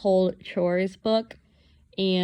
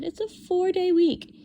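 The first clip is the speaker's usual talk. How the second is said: cheery reading of a note; 11 semitones higher, 4 dB louder than usual. Retold or imitated. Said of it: "And I said".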